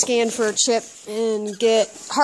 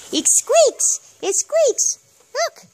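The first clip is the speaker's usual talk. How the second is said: squeaky pet talk; 12 semitones higher, slower than usual. Real, not acted. Baby talk to the dog.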